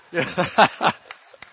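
a burst of real laughter, rising; loud laugh. Real, not acted. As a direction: laugh out at the memory. Laughing at brother's plight.

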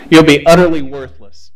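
booming it out to the graduates; loud blunt warning. Retold or imitated. Professor warns graduating class, in a loud blunt voice. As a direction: hit them hard with the bad news.

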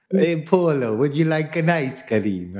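high, loud, drawn-out bad Italian accent; bad mock Italian accent. Joking about Italian ice-cream families, badly faked Italian accent. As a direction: ham up an Italian accent until it slips towards Indian. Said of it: "ice cream from the Italians".

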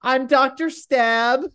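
hammed-up high professor voice; imitating the professor. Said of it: "he talks like this".

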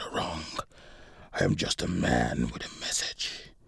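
very low, whispery villain monologue; Antonio, sinister executioner. A narrator's reading. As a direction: deliver a dark sentence in a deep murmur.

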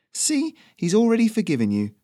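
high, easygoing chatty messenger; Ferrino's voice. Acted. Messenger reassures the nervous local, high and easy.

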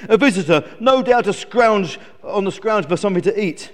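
loud, complaining eagle character; lightly voiced moaning eagle; grumpy eagle. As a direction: grumble about an unwanted visitor.

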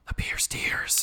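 hushed dramatic whisper. Acted. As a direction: breathe it out unvoiced. Dramatic whisper after a gasp.

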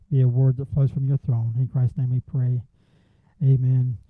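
soft, earnest prayer voice. Acted. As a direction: pray quietly before the congregation. Opening prayer before sermon.

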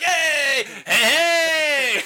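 shouting greeting. Acted. Refugee Russell running over, shouting to the group. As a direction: yell his hello from far off. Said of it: "And he's like".

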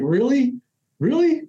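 a mock-incredulous double take, going up; incredulous really. Supervisor doubting him, mock incredulous rising really.

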